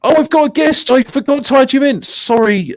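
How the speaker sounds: high, hurried realisation; flustered apology. Real, not acted. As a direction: pitch up as you remember your forgotten guest. Host realises he forgot guest.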